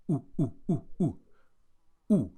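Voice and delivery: short clipped "u, u, u" like a monkey; monkey sound u. Shown as a demonstration. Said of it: "the sound a monkey makes".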